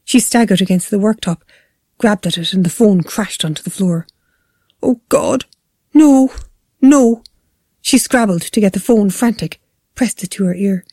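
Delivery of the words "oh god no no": high, anguished outcries; raised cries, growing louder; horrified cries. A narrator's reading. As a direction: cry out in mounting panic. Mother drops phone in horror.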